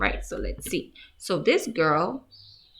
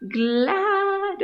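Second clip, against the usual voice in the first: high, louder happy lift; glad; 12 semitones higher, 5 dB louder than usual. Shown as a demonstration. Teacher offers children the feeling glad, rising happily. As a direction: brighten up on the word glad. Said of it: "perhaps you are feeling".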